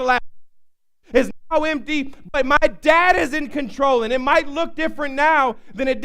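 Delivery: the preacher drives home that the dad is in control; preaching. Acted. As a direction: preach it to the congregation.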